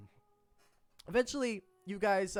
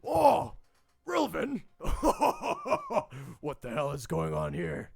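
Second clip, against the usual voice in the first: just-woken confused yell; groggy goblin jolted awake, loud; startled Gizrot; 3 semitones higher, 7 dB louder than usual. Acted. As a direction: wake up panicked and suspicious. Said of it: "Gizrot wakes up".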